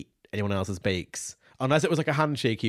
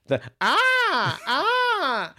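high rising then falling shriek; camp pyramid scream; 4 dB louder, much slower than usual. Shown as a demonstration. Demonstrating a pyramid scream on request, high shriek. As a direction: shriek up and down like a triangle. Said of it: "give us a quick, quick example".

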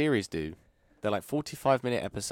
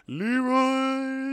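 high drawn-out battle cry; Leroy Jenkins style; 4 dB louder than usual. Pretend. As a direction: stretch it like charging into battle. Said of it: "Duncan Idaho".

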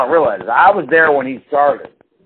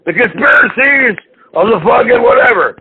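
high, loud ranting conspiracy host; Alex Jones impression; 9 semitones higher, 4 dB louder than usual. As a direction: shout it like a frantic radio ranter. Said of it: "Alex Jones".

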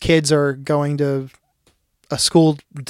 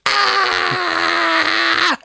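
high laughing cry; laughing shriek; 13 semitones higher than usual. Real, not acted. Friend cracking up at his own story with a high shriek.

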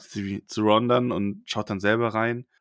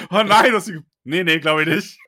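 higher and louder huffy denial; indignant Trelawney; 4 semitones higher, 5 dB louder than usual. Pretend. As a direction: scoff like an offended diva. Trelawney widerspricht Hermine empört. (empörte Trelawney, höher und lauter)

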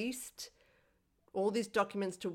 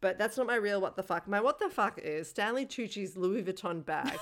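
ordinary delivery

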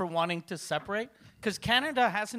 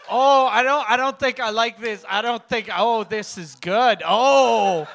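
loud mock moaning protest; hammed-up reaction; 2 semitones higher, 11 dB louder than usual. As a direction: complain loudly, then give in with exaggerated relish. Re-enacting his reaction, loudly.

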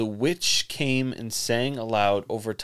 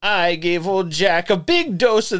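witch voice; 7 semitones higher, 4 dB louder than usual. Acted. Witch quote read in creepy voice.

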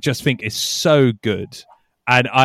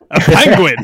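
louder, higher mock eureka; excited shout; 5 semitones higher, 9 dB louder than usual. Shown as a demonstration. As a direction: blurt it out like a big discovery. Punchline shouted with a laugh.

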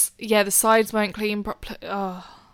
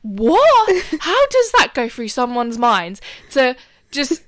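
higher, louder stunned outburst; retold disbelieving rant; 3 semitones higher, 5 dB louder, slower than usual. Sister ranting at the siblings, disbelieving. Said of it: "and I'm like".

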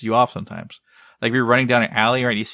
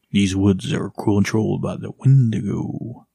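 slow, ominous warning; ominous game quote; slower than usual. Retold or imitated. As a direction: read it like a horror trailer. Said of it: "One of the lines from the game".

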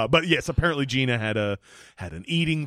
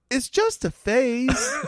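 put-on little girl voice; 10 semitones higher, 3 dB louder, slower than usual. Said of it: "And she just says".

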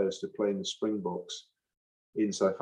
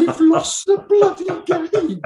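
much higher and louder, slow, bloody hammered; exasperated yell; 11 dB louder than usual. Retold or imitated. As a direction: yell it in disbelief at the stats. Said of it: "And you go".

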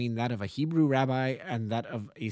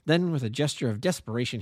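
ordinary delivery

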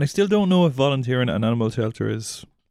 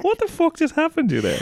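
giggly disbelief. Real, not acted. Both laughing after mix-up.

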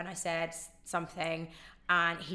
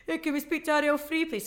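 higher, louder Scottish lad calling in; imitating a Scottish stag-do caller, eager; 8 semitones higher, 6 dB louder than usual. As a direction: put on a cheery Scottish accent. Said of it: "so they're really Scottish".

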